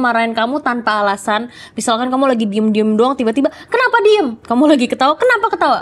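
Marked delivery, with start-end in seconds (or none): 3.7-4.4 mock scolding mom. 5.1-5.8 mock scolding mom.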